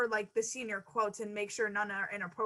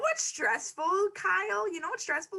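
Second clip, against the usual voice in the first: sarcastic retort; 7 semitones higher than usual. Acted. Mock retort to quoted boy.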